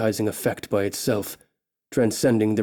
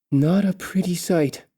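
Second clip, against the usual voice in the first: higher, a touch of dry distaste; lightly voiced elf thought; 7 semitones higher than usual. Elf thinking about the orc, higher and wry. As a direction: pitch it up, wry and disgusted.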